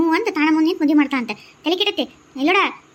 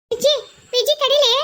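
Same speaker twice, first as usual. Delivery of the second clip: loud, high call to stop her; urgent call to wait; calling after her; 5 semitones higher, 4 dB louder, slower than usual. Acted. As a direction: shout her name as she walks away. Calling after leaving Viji.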